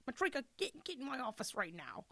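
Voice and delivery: mock English-accented boss summoning an employee; put-on English accent, mock stern. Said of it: "a guy with an English accent".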